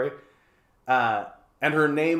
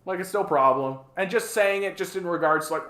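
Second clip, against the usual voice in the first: ordinary talk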